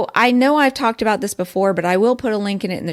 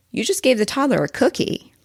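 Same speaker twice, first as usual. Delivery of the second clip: ordinary talk, nothing marked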